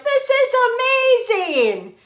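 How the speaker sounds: high-pitched gush, then dropping back; mock amazement. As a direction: play the thrilled beginner. Acted thrill at early weight loss.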